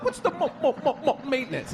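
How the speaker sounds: high-pitched imitated stutter; worsening stutter. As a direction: jam repeatedly on the M, pitched high. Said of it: "the worse the stutter gets".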